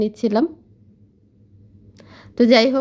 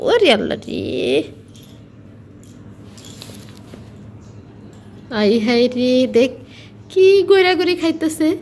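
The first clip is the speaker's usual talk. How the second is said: amused exclamation; 2 semitones higher than usual. Real, not acted. Amazed as bird rolls about.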